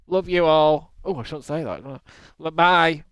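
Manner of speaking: ordinary delivery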